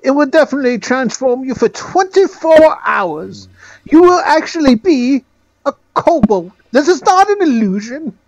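high-pitched little gnome; a squeaky, self-important gnome. Acted. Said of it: "I don't know what accent I'm, voice I'm giving this time".

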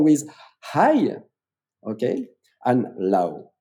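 ordinary talk, nothing marked

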